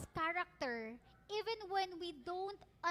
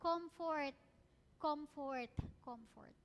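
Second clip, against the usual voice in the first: comfort, comfort, comfort, each softer, last almost gone; repeated comfort fading; 3 semitones lower, 3 dB quieter, much slower than usual. Shown as a demonstration. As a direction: let each comfort fall away softer. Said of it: "ang unang term na ginamit agad". (comfort, comfort, comfort, pahina nang pahina)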